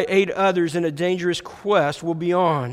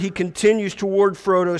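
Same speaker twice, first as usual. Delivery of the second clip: ordinary delivery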